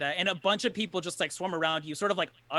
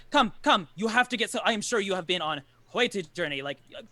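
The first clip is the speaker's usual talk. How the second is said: higher-pitched, hospitable character voice; eager camp host; 3 semitones higher, faster than usual. Acted. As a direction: usher weary travellers in eagerly. Game master voices welcoming camp folk.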